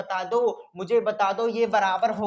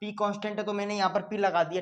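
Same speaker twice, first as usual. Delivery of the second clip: ordinary talk, nothing marked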